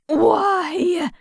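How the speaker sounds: high, cracked groggy whine; broken, gravelly voice. A narrator's reading. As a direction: croak it out miserably, half asleep. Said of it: "her voice sounding like it was broken in pieces on a gravel road".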